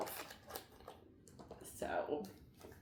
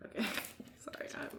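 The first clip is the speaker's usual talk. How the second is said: laughing; 2 semitones higher, 9 dB quieter, much faster than usual. Real, not acted. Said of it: "I'm laughing at myself now".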